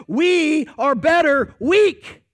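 loud, slow declaration, rising on weak; declaring loudly. Acted. Pastor proclaiming the point to his congregation, loud and slow. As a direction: proclaim it slowly to the back row. Preaching climax, then echoed softly.

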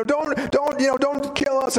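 high-pitched, stammered begging; mock pleading. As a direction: imitate demons begging for mercy. Demons begging Jesus not to kill them.

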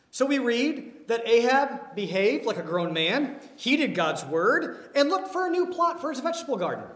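tongue-in-cheek fake scripture; mock sarcastic reading. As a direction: sell the false version before taking it back. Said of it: "No, no, we, we don't read that at all".

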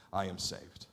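low and soft, almost to himself; hushed confession. Real, not acted. Pastor to congregation about meeting Jesus, hushed and reverent. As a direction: breathe it out quietly in reverence.

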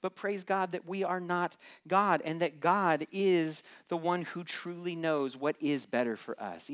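ordinary talk, nothing marked

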